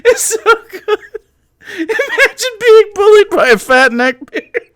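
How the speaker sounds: words squeezed out through laughter, high; can barely speak for laughing. Real, not acted. Streamer cracking up at troll.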